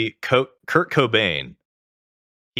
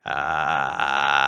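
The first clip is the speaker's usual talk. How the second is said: low, sustained gravelly "ah"; vocal fry; 6 semitones lower than usual. Shown as a demonstration. Singer demonstrating vocal fry, low gravelly bubbling. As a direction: bubble a long fry in the back of the throat. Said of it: "when you're doing a vocal fry".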